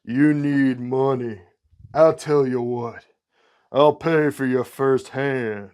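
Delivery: slow, lightly done Mississippi drawl; a hint of a sly southern tempter; southern gambler offering to pay. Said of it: "Mississippi southern drawl".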